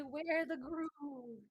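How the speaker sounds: slow, drawn-out warning; slow movie-line quote. Retold or imitated. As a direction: intone it like an ominous movie line. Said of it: "You gotta do that".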